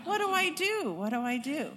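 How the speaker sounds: high, helpless wail of a retold panic; mock panic. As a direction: act out a flustered beginner, pitch jumping up then falling. Acts out her panic with baby.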